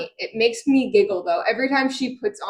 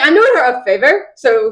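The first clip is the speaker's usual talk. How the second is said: loud, sassy retort; 3 semitones higher, 7 dB louder than usual. Retold or imitated. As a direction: toss it back with mock sass. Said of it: "he's just so sassy".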